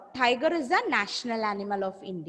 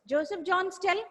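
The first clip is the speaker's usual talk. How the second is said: normal talking voice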